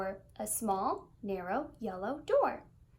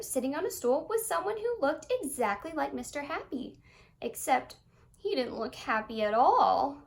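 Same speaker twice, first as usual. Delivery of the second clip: plain reading voice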